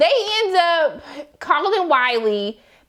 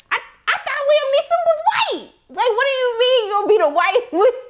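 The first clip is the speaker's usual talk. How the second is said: incredulous; 9 semitones higher than usual. Real, not acted. Incredulous at the Liam Neeson line.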